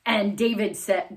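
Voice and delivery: plain reading voice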